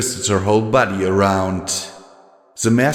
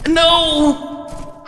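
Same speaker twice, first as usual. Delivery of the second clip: scream. A narrator's reading. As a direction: scream it in horror. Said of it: "Shuja screamed".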